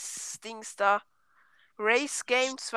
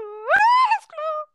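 much higher, very slow wailing cry; mock wail; 7 dB louder, much slower than usual. Boy wails at his friend in mock despair. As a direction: stretch it into a long theatrical wail.

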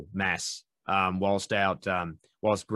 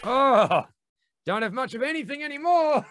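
much higher, hammed-up dazed voice; silly high voice; 4 dB louder than usual. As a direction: play a spaced-out drug user. Silly voice about feeling nothing.

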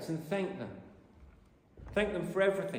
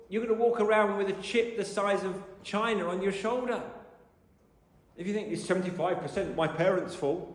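ordinary talk, nothing marked

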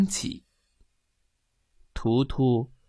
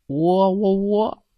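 slow, higher imitated rooster crow; 6 semitones higher, 4 dB louder, slower than usual. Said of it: "拉尔夫很和气". (慢慢地模仿公鸡喔喔叫)

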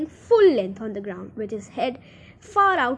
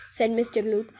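plain reading voice

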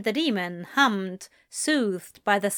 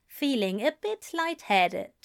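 plain reading voice

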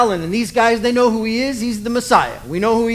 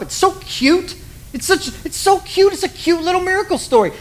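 higher, gushing about how cute it is; gushing excitement; 8 semitones higher than usual. Real, not acted. Pastor gushing to the congregation about a cute story, excited and high. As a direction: gush like you just found a treasure. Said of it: "I was so amped up".

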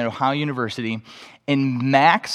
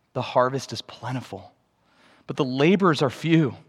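soft, measured Bible reading; hushed, reverent recitation with pauses; scripture reading; 2 semitones lower, 4 dB quieter than usual. A narrator's reading. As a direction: read it gently for listeners with eyes closed. Reads a Bible passage aloud.

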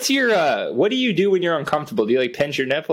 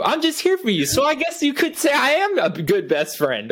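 mock supportive friend; 8 semitones higher than usual.

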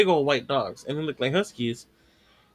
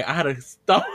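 ordinary talk, nothing marked

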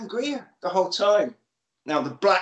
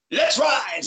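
rallying cry; 7 semitones higher than usual. Acted. Gang leader rallying cry.

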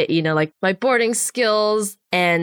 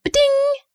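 chirpy ding. Retold or imitated. Imitating the email alert.

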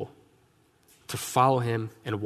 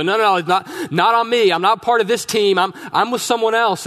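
much higher and louder, heated protest; furious denial; 7 semitones higher, 7 dB louder than usual. Retold or imitated. Peter cursing to deny he follows Jesus, furious. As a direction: shout them down to prove you're not one of them. He's, like, dropping F-bombs.

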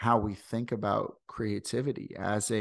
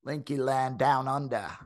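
mock Australian accent; 3 semitones higher than usual. Host jokes about his Australian guests, mock Australian accent. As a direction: ham up an Australian accent, pitched up. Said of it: "trying to do the accents".

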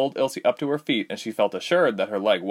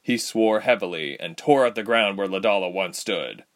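usual speaking voice

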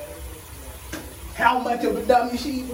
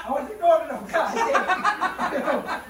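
laughing; 7 semitones higher, much slower than usual. Real, not acted. Burning mouth, laughing it off.

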